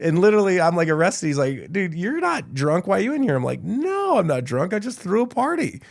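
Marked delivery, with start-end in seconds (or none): 1.7-3.4 puzzled guard. Retold or imitated. Retold jail guard questioning him. 3.6-5.8 indignant protest. Retold or imitated. Retold own protest in jail.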